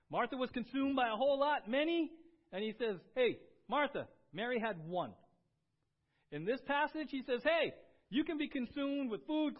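loud, high, driving sermon voice; preaching at full force. Acted. Pastor calling out his church, full-force preaching. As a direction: preach it hard to the pews.

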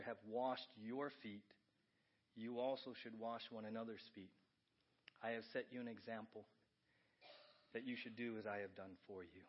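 low, soft, measured reading of Jesus' words; scripture reading. Acted.

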